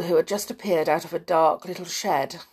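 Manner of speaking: ordinary delivery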